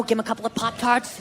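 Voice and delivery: ordinary talk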